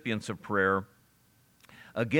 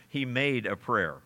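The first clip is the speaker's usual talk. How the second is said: ordinary talk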